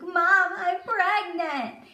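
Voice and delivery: high, loud wail with drawn-out words; mock hysterical crying. Overwhelmed daughter telling her sleeping mom, mock wailing. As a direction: imitate blurting it out through overwhelmed tears. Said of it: "I started hysterically crying".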